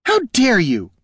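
high, affronted gasp; gasped outrage. A narrator's reading. Jelana insulted by her double, gasped outrage. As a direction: gasp it out, scandalized. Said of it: "gasped Jelana".

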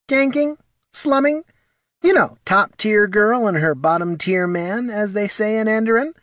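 very high, prissy little voice; fussy, superior small kobold; haughty kobold Morty. Acted. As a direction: lecture primly in a tiny high voice.

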